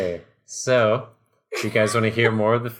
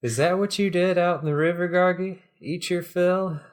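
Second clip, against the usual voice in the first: playful ribbing in a higher voice; teasing; 7 semitones higher than usual. A narrator's reading. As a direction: tease a friend in a character voice. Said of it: "He's teasing him".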